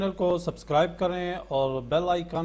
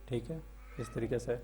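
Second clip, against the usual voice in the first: ordinary talk, nothing marked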